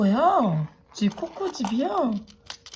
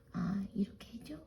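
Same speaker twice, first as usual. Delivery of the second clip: usual speaking voice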